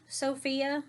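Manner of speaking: usual speaking voice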